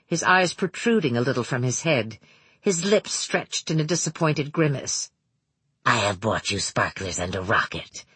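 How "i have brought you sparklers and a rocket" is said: deep, measured villain voice; Manx's low voice. A narrator's reading. Narrator voices Manx.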